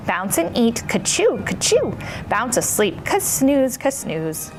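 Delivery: usual speaking voice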